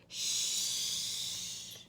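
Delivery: soft unvoiced shush. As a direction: hush gently.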